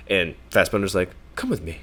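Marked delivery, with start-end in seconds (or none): 1.3-1.8 soft, calm and even; mock calm invitation, much softer; calmly. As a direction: say it hushed, unbothered. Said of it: "very calmly".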